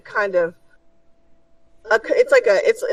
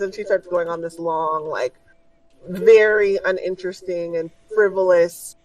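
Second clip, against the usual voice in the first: ordinary delivery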